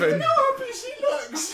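high, laughing tease; laughing squeal. Real, not acted. Teasing a co-host about her face, a high, laughing squeal. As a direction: squeal the tease through giggles.

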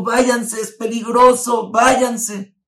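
high, loud, panicked order to leave; scared woman yelling at the girls to go; frightened shout. Retold or imitated. Frightened woman sends the girls away, shouting. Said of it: "Y ella se asustó, sabía que era peligro".

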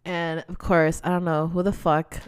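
ordinary talk

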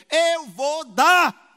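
loud, drawn-out, very slow pledge; emphatic shout. Real, not acted. Pastor vowing to the congregation, booming and slow. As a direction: shout the promise to the church.

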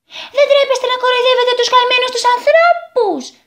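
put-on very high, stern little girl; scolding girl. A narrator's reading. Sunburnt girl scolds the mocking boys, high and stern. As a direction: tell them off like a strict girl. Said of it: "είπε".

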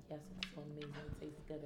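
savoring. Retold or imitated.

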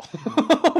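bright burst of laughter; high giggle. Real, not acted. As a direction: giggle at yourself. Laughing about visiting shady bars.

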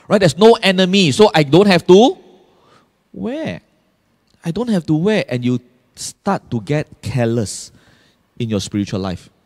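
normal talking voice

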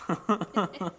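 loud dopey Kronk. Retold or imitated. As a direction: boom it out like Kronk. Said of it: "Kronk from Emperor's New Groove".

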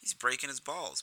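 usual speaking voice

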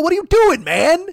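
high, loud baffled cry; incredulous outburst. Retold or imitated. Baffled in the stall, crying out in disbelief. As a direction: ask in disbelief at the mess.

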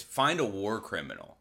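ordinary talk, nothing marked

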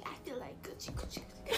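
hushed, voiceless repeat; playful whisper. Real, not acted. Friend playing on feeling like Gucci, whispered. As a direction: let it drop into a giggly whisper.

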